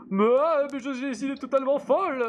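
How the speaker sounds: high, mad villain voice; voix de méchant. Retold or imitated. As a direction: play the raving madman villain. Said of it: "avec une voix de méchant du genre". (voix de grand méchant fou)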